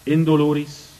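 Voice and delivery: ordinary talk, nothing marked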